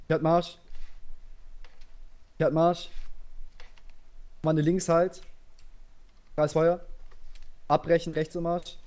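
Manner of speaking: calling orders to a line; barked drill commands. Real, not acted.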